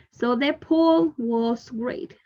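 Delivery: slow model reading. Shown as a demonstration. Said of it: "teacher models the sentence slowly".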